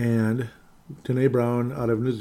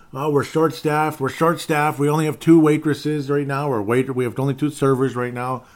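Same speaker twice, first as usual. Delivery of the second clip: sarcastic singsong imitation of staff; mocking whine; 4 semitones higher, 6 dB louder than usual. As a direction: parrot the excuse with contempt.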